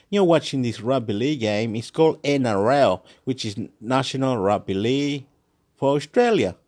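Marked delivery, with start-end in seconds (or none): none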